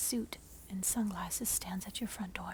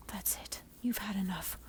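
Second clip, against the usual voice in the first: usual speaking voice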